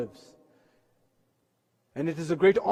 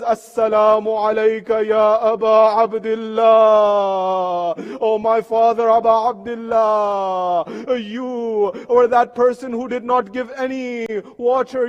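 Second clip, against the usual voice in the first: grieving lament; 3 dB louder than usual. Acted. Imam Sajjad turning toward Karbala to greet his father, loud grieving lament. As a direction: call out to the martyred father, then let it fade.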